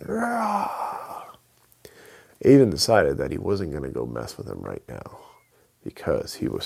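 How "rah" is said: a high, roaring Bigfoot cry; monster roar. Acted. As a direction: let out a wild creature roar. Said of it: "and screaming".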